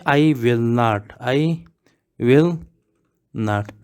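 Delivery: I will not, each word drawn out; slow model. Shown as a demonstration. Teacher explains that won't means I will not, slowly. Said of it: "इसका मतलब क्या है?".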